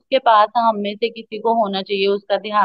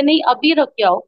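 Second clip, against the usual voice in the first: stern scolding; 6 semitones higher, 5 dB louder than usual. Retold or imitated. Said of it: "उस दिन उन्होंने मुझे डांट के बोला".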